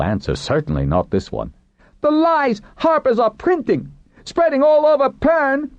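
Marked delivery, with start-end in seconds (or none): 2.0-5.7 very high, agitated outcry; impassioned cry. A narrator's reading. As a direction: cry out the accusation, voice way up. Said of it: "the man cried".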